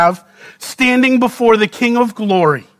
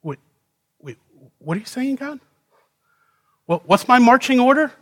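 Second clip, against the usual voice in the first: fast, higher, bewildered; confused protest; 4 semitones higher, 3 dB quieter, faster than usual. Acted. Isaiah confused by God's strange marching order, bewildered and fast. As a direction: pull up short in disbelief.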